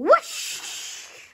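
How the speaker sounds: breathy rushing whoosh; whoosh sound effect. Acted. Child reading a race story, voicing the car's whoosh. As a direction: blow it out like a car flying past. Car soars through the air.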